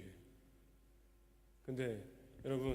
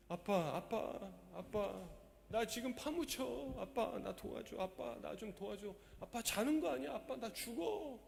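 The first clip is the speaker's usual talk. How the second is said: put-on a light child's voice, higher and slow, pleading; child crying for dad; 6 semitones higher, 3 dB quieter, slower than usual. Pastor voicing a child buried in a landslide, a light pleading child voice. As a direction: play a buried little kid begging father for help. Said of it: "마치 아이가".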